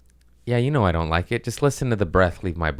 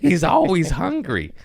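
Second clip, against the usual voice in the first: pitched up and exaggerated; higher squeaky repeat; 10 semitones higher, 3 dB louder than usual. Shown as a demonstration. Repeating the punchline higher while the cohost laughs. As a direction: push it up high for the joke.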